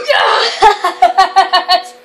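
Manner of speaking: loud cry breaking into laughter; triumphant relief. Real, not acted. Relief at surviving night.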